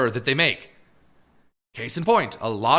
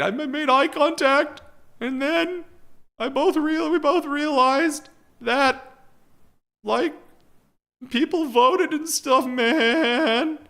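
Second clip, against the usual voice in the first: very high-pitched mocking imitation of a distraught person; mock devastated; 13 semitones higher, slower than usual. Host mocking the devastated video makers, high mock-devastated voice. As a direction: put on a high voice, halting with pauses, as a parody of heartbreak. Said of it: "like, they're so devastated".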